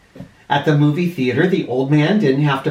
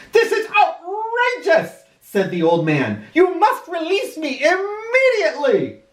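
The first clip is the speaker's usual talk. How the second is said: put-on high, pompous outraged old gent; indignant old man. Old man to the paramedics, high and pompously outraged. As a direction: huff like an affronted old gentleman.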